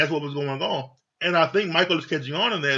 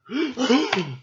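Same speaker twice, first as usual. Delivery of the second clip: sharp, high gasp of feigned shock; mock offended gasp; 7 semitones higher than usual. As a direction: gasp as if deeply insulted. Acts out the offended wife.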